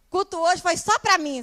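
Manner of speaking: high, loud, exaggerated enthusiasm; gushing mock church member. Imagined member gushing to her leader after service, overexcited. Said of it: "ela não saiu daqui dizendo".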